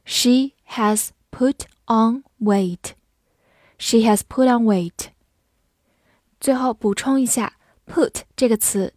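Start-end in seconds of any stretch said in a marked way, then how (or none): 0.1-2.9 one word at a time; slow teacher's model with pauses between words; slow read-through. Shown as a demonstration. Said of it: "好，慢读一遍".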